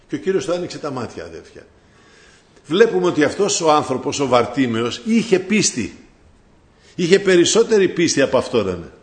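normal talking voice

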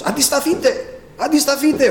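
high, urgent re-enacted warning cry; retold loud shout. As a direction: call out to rally the congregation, pitch way up. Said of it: "άρχισα να φωνάζω δυνατά στους αδελφούς".

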